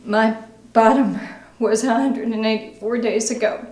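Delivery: halting confession, growing distraught; anguished recovering alcoholic. Acted. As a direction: let the guilt build through heavy pauses. Acting exercise, painful confession about daughter.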